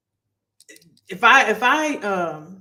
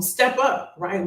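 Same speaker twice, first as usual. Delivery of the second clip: usual speaking voice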